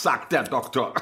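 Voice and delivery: ordinary delivery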